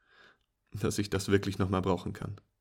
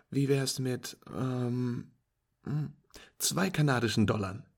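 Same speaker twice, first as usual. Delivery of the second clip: higher, louder pleased voice; cheerful friend; 4 semitones higher, 5 dB louder than usual. Acted. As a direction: praise the beginner's lucky shot.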